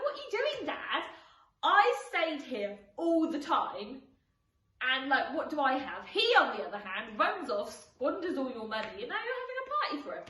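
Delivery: loud, indignant complaint to his dad, pitch up; older son protests to his father about the party, annoyed and loud. Acted. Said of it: "the older son's really annoyed".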